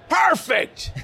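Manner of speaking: high, loud Scottish burst of delight; Scottish shout. Retold or imitated. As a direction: bark it out with a manic Scottish brogue. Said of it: "the Scottish".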